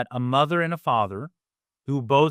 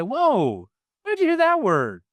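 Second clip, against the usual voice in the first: acted surprise; 10 semitones higher, 4 dB louder than usual. Parent to a kid who said something, exaggerated surprise. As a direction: leap high on a drawn-out reaction. Parent models reaction to swearing.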